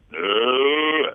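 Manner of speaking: loud moo demo. Shown as a demonstration. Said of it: "don't put your M on it".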